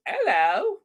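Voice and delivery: British "'ello", rising at the end; drawn-out mock-British hello; [name]'s mock British hello. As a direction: imitate a playful British greeting without the H. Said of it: "that was Megan's hello".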